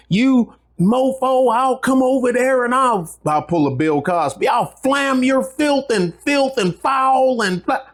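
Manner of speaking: high-pitched mimicry of crude commenters; mock commenter rant. Veteran mimicking commenters who post foul threats, high mock rant.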